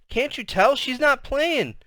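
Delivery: high, slow wailing question; exasperated, frightened child; desperate little sister. A narrator's reading. Sister pleading with brother.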